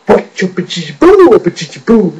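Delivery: hammed-up mouth-noise riff; self-parody. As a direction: belt out silly drawn-out syllables. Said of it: "an impression of me".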